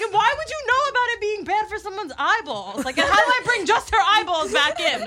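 high, laughing incredulous questions; mock disbelief. Friend arguing with Joyce in the van, high laughing disbelief. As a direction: question it like it's absurd. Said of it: "I was like".

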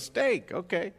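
high, amused confirmation; high playful butcher. Retold or imitated. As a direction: play along with the dog lightly. Butcher confirms dog's order.

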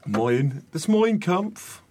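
slow Brummie try. Shown as a demonstration. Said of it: "Does that work?".

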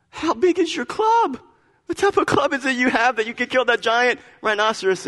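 high, amazed voice of the passerby; a man's disbelieving question, voiced high. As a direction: play the stunned man quizzing the cub. Man in joke questions cub.

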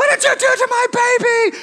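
high-pitched outraged mom shriek; horrified mother. Acted. Horrified mother after mimed baby dunking.

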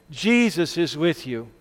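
normal talking voice

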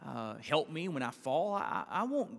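ordinary talk, nothing marked

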